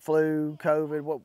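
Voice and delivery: normal talking voice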